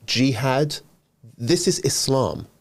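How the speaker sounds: ordinary talk, nothing marked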